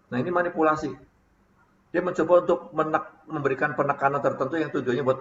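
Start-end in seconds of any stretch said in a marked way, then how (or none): none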